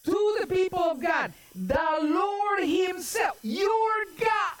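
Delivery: high-pitched, driving sermon delivery; forceful preaching. Acted. Preacher retells the enemy's threat to God's people, forceful preaching. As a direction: preach it high with drawn-out key words.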